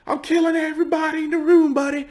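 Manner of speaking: high, put-on psycho voice; mock a deranged shooter; killer voice. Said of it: "multiple homicides".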